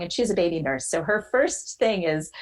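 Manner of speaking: ordinary talk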